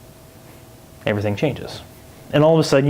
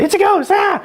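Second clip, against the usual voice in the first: mock terrified shriek. Sunday-school viewers seeing something shadowy, hammed-up terrified shriek. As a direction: shriek it like a fake scare. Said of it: "we don't say".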